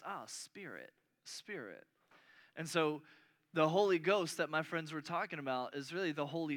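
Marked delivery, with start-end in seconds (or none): 0.0-1.9 soft realization. Acted. Translators arriving at the answer.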